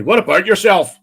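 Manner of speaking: loud, high-pitched Northern Irish greeting; Northern Irish accent. Acted. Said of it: "People born on that island".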